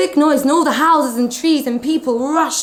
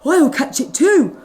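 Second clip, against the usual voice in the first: little dog's thought; 5 semitones higher, 4 dB louder than usual. Acted. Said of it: "thought a little dog".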